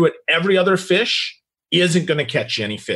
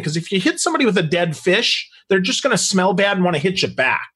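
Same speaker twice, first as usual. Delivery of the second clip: ordinary delivery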